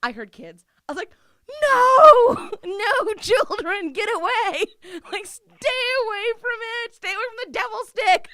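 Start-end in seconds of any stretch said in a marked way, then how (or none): 1.6-8.3 high, loud, laughing yell; frantic shout. Retold or imitated. Yelling at the children to stay away from the dildo, frantic laughing shout. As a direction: shoo the kids off, shouting through laughter.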